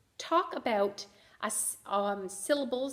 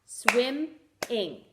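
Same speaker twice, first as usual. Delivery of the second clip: "Swimming" broken into swim, pause, ing; teacher sounding out "Swimming" syllable by syllable; split into syllables. Shown as a demonstration. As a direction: say "Swimming" in two separate clapped beats. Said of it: "It has two".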